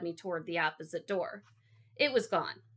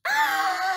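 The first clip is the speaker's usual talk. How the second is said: longest, highest, loud shriek; relentless full-blast scream; all-out scream; 9 dB louder than usual. A narrator's reading. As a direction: scream until you run out of air. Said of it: "I was finally getting into it".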